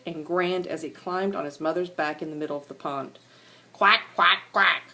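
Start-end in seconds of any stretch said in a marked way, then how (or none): 3.7-4.8 louder quacks, bright and bouncy; duckling quacks. Acted. Yellow ducklings quack on mother's back, loud bright quacks. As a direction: quack like proud little ducklings. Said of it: "said the yellow ducklings".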